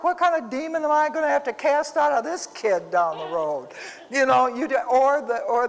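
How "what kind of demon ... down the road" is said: higher, half-joking exasperated question; mock alarm. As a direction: play up the horror for laughs. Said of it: "I'm like".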